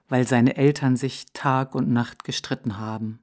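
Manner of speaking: put-on a wise old owl explaining calmly; old owl's voice. Owl explaining to the hare, low and steady.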